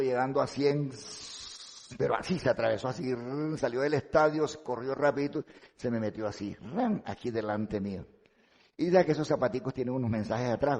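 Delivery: ordinary talk, nothing marked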